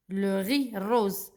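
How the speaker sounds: ordinary talk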